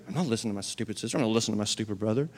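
low, soft, fast grumble; mock sullen mumble; sulky kid. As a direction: sulk like a kid ignoring a sibling. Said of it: "they're not listening".

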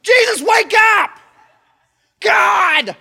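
loud, high frantic yell; panicked shout. Retold or imitated. Disciples yelling at sleeping Jesus in the storm, frantic shout. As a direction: scream him awake in panic. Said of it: "Mm, no".

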